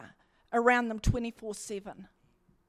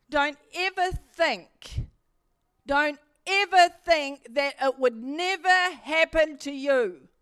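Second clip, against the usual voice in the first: loud, slow and urgent, stress on ever; fervent warning; 4 semitones higher, 7 dB louder than usual. Real, not acted. Preacher to congregation, urgent loud warning. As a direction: plead with the church, don't ever think.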